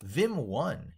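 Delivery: ordinary talk, nothing marked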